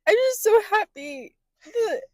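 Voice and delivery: high, teary fake sobbing acceptance speech; hammed-up crying; mock tearful speech. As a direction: gush through fake tears at the Oscars.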